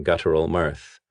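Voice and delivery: plain reading voice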